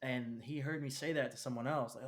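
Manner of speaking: ordinary talk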